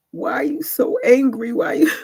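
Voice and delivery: a hint of a mentor asking why so angry; mentor's question. Mentor asking her why she's so angry, lightly voiced.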